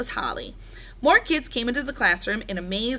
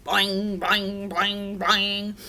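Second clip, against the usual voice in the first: monotone, repeated boings; frog boing noises; much slower than usual. A narrator's reading. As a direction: imitate a twanging frog, evenly spaced. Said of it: "Og splashed loudly".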